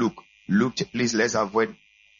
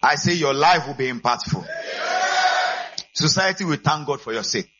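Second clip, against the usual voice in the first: raised voice, pushing for amen; raised, emphatic repeat; louder prayer; 5 semitones higher, 3 dB louder than usual. Shown as a demonstration. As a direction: say it again higher and louder to rouse the church. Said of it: "it's sounding on one leg".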